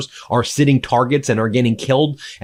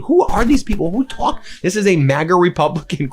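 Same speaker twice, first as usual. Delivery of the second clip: usual speaking voice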